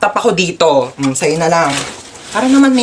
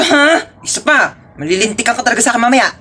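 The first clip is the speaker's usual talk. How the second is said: normal talking voice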